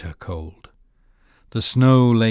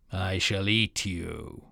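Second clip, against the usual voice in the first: slow, soft wolf threat; menacing wolf; 6 dB quieter, slower than usual. A narrator's reading.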